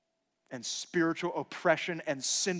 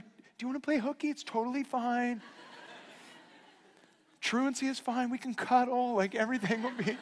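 higher, giggly playful offer; playful coaxing; 12 semitones higher, 4 dB louder than usual. Retold or imitated. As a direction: tempt her kindly, laughing as you go. Dad voicing offer to his daughter.

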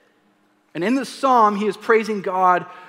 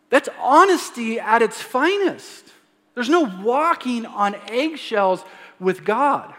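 ordinary talk, nothing marked

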